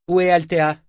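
high, loud, commanding question; imperious demand. A narrator's reading. Freed woman demanding her friend, high and imperious. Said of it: "demanda-t-elle impérieusement".

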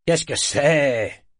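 appel plus fort, lent et rauque; cri rauque. A narrator's reading. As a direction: crier à travers la porte, voix éraillée de sommeil. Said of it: "cria-t-il d'une voix rauque". (Kénit réveillé en pleine nuit crie vers la porte)

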